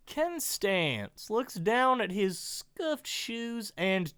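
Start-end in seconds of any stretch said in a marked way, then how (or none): none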